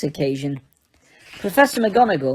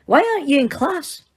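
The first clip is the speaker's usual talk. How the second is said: higher, sharp stern teacher; snapped; 9 semitones higher than usual. A narrator's reading. Stern teacher scolding a shouting student, sharp. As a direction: bite off the question sternly.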